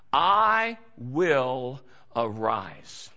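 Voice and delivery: very slow, drawn-out declaration; solemn resolve. Acted. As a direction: lay out each word with weight. Quoting prodigal's resolve.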